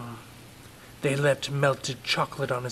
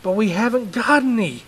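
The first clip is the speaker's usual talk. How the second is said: higher, louder explaining dad; pleading father; 9 semitones higher, 9 dB louder than usual. Acted. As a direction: plead with the boy.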